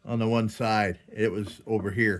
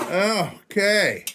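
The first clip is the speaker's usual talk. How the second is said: excited; 9 semitones higher, 4 dB louder than usual. Real, not acted. Lantern finally fixed, elated.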